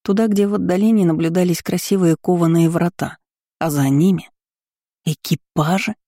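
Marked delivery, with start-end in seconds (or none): none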